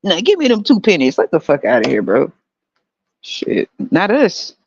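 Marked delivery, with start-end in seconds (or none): 0.0-1.2 higher mock pleading voice. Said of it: "And I said".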